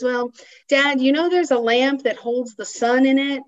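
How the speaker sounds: retold young son. Son tells his dad about the lamp, lightly voiced child. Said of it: "his son".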